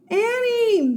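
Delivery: high, loud shout; shouting a name. Acted. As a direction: shout for his lost sister. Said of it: "he called".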